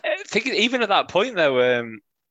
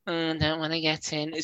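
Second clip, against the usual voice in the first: softer, whining complaint; whiny voice; 3 semitones higher, 6 dB quieter than usual. Retold or imitated. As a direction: whine it like a moaning fan. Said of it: "This is just my whiny voice".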